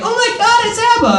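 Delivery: very high squealing gasp of excitement; mock fanboy squeal. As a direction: squeal it like a thrilled fan. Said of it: "'cause he's like".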